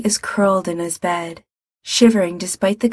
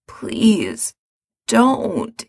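a hint of sick hoarseness, pleading; hoarse; 3 semitones higher than usual. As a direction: beg weakly through a sore throat. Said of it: "he says hoarsely".

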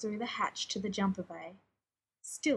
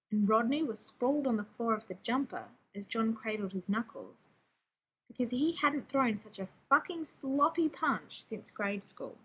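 usual speaking voice

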